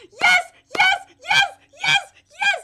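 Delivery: high, loud, repeated shrieks of joy; ear-splitting cheering. Real, not acted. Fan cheering the coming confession, ear-splitting shouts. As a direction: burst out in piercing cheers. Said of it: "I'm sorry if I'm hurting headphone users".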